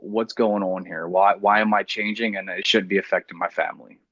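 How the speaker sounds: usual speaking voice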